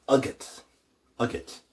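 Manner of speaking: Agat said as AH-gut; Irish word. Shown as a demonstration. Teacher modelling Agat for learners. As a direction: Agat twice, stress on the first syllable. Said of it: "it becomes".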